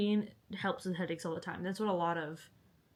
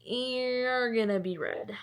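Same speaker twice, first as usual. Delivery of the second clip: long, stretched YOU'RE, slow delivery; drawn-out vow; 3 semitones higher, slower than usual. Acted. Reader to a newly found book, slow relishing vow. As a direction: drag out "You're" before the rest.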